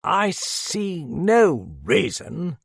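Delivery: high, slow, drawn-out protest; indignant protest. A narrator's reading. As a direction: object loftily, stretching each word. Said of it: "protested a voice behind him".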